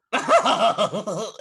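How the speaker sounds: put-on high cackle trying to be girlish; a witch's attempt at a coy giggle; girlish hag laugh. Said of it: "her version of a girlish laugh".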